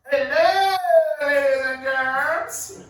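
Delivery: milk the opener like a cheesy emcee; stand-up emcee opener. Acted. Pacey opens open mic night.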